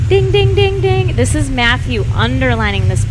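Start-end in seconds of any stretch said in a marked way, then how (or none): 0.1-1.2 high, repeated imitated chime; bell dinging. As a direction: ring it out like an alert bell.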